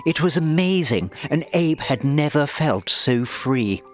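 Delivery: usual speaking voice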